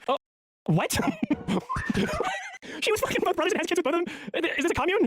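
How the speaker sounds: high, squeaky incredulous laughter; real cracking-up astonishment; laughing disbelief. Real, not acted. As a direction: blurt shocked questions through a laugh. Reacting in disbelief to clip.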